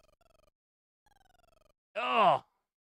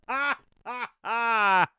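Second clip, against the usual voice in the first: laughing; 9 semitones higher, 5 dB louder, much slower than usual. Real, not acted. Laughing at Clinton clip.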